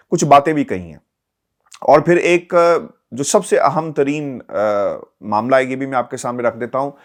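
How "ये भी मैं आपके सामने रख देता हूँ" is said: ordinary talk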